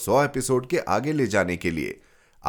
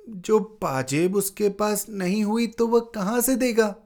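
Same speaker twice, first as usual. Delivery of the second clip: put-on high, small boy's stubborn question; child's voice; 11 semitones higher than usual. Young Ashutosh retorts to his father in a high child's voice. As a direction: answer back like a sulky little boy. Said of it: "उसने कहा".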